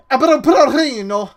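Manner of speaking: loud, high mock foreign "Ibuprofeno"; mock foreign accent. Teasing a friend, loud mock foreign "Ibuprofeno". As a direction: say "Ibuprofeno" as a loud, high Spanish-ish parody. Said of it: "how do you say it in English".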